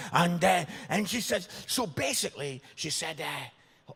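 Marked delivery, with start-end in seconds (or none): none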